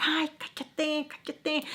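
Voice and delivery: high, slow weary dismissal; imitated exasperation. Husband shushing his wife in an argument, exasperated drawl. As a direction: stretch it out, fed up.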